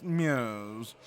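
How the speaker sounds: nasal, drawn-out British bluster; very posh British, humming into the words. Shown as a demonstration. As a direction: bluster through it like a snooty Brit. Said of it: "a little bluster".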